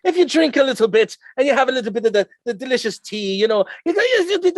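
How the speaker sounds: high-pitched, wobbly Irish brogue; bad Irish accent. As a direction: put on a not-very-good Irish lilt. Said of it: "not doing a very good Irish accent right there".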